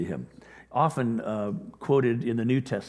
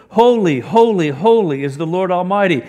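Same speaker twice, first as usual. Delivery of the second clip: much higher and louder, proclaiming holy; calling out; 5 semitones higher, 10 dB louder than usual. A narrator's reading. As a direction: ring it out like angels crying to each other. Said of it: "they were calling to one another".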